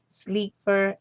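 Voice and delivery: ordinary delivery, nothing marked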